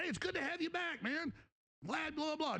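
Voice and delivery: high, breezy fake welcome; mock chummy greeting. Mocking his own false welcome to the returning host, high and breezy. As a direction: sing it out like a fake buddy.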